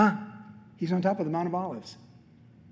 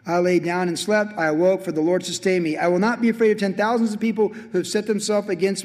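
loud, raised psalm-reading voice; declaimed scripture; 3 semitones higher, 4 dB louder than usual. Acted. Preacher reading David's cry against enemies, forceful declamation.

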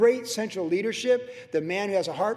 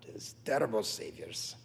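put-on Russian accent; 8 semitones lower, 6 dB quieter than usual. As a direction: imitate a Russian speaker, deep and deliberate. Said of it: "with his Russian accent".

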